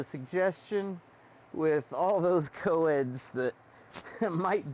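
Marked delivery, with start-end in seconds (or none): none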